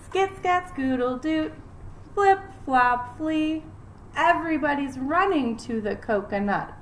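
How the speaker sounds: lively rhythmic picture-book reading; reading to children. Acted. Teacher reading a rhyming book to her class, lively storytime voice. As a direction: read it bouncy for little kids.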